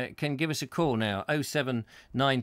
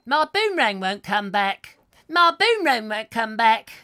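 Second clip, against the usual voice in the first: high, louder comic sing-song; 7 semitones higher, 6 dB louder than usual. Retold or imitated. Host does the old comic's song line, high and loud. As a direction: wail it like a sad little comic. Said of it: "a bit like [name]".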